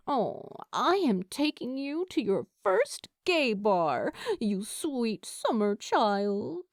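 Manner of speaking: fond teasing, slowing on the last words; teasing friend. A narrator's reading. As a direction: savour the endearment like doting on a naive kid. Lou squeezing Steed's cheeks, teasing.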